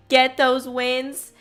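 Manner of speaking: ordinary talk, nothing marked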